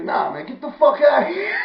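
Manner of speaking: high, loud mock yell; hammed-up indignant shout; shouted dismissal. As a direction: imitate someone angrily ordering you out. Acts out ordering him out.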